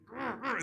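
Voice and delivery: strained gurgling growl; imitating a choking grunt. Imitating choking man's noise.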